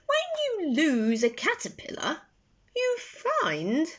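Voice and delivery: put-on a kindly old friend explaining; wise cafe owner. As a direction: let him share a secret gently. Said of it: "said Mister Rossetti".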